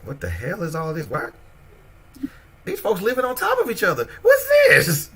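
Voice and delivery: reacting to crowded Brooklyn, voice climbing in shock; culture shock. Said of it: "I'm like".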